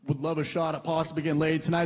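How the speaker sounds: ordinary talk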